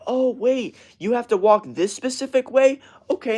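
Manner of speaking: high-pitched, indulgent parent imitation; mock sympathetic parent. Parent indulging a kid's specific walk, mock sympathy. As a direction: coddle the kid in a mocking high voice.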